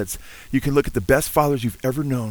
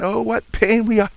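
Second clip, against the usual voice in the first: fervent exclaim; 9 semitones higher, 3 dB louder than usual. Real, not acted. Preacher's fervent aside.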